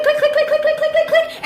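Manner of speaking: high laughing clicks; high, laughing magnet clicks; high clicking sound. Retold or imitated. Mimicking magnets clicking together.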